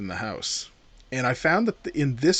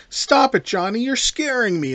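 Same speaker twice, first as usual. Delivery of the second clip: high-pitched mock-frightened plea; Barbara impression; 8 semitones higher than usual. Podcast host quoting the horror heroine, higher mock-scared voice. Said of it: "your Barbara impression".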